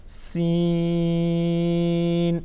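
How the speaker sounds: سین with a sharp whistled s; drawn-out سین with a hard, hissed start; hard seen. Shown as a demonstration. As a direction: stretch سین long on one note, s firm. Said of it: "سین کو سخت پڑھتے ہیں".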